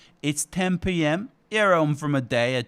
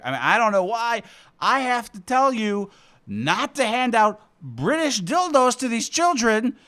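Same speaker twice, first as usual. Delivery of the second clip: normal talking voice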